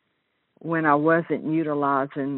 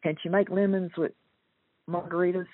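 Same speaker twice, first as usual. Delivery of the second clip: ordinary talk, nothing marked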